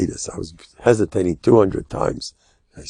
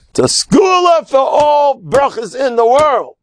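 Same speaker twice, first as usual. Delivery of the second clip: high ringing shout of praise; exultant shout; 14 semitones higher than usual. Acted. Rabbi hailing the awkward guy's gift, high ringing shout. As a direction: cry it out as a blessing. Preacher proclaims a blessing.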